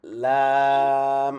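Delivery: লাম held very long, tongue edge on the ridge; drawn-out lam demo. Shown as a demonstration. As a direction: stretch লাম out slowly from the makhraj. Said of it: "মানে দেখেন মাখরাজটা". (লাম দীর্ঘ টেনে মাখরাজ থেকে)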